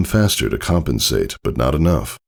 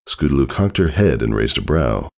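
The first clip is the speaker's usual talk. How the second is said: ordinary delivery, nothing marked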